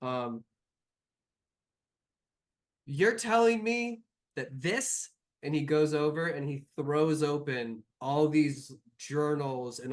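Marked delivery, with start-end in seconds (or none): 2.8-5.1 incredulous. Acted. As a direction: voice jumps up in shock. NPC incredulous outburst.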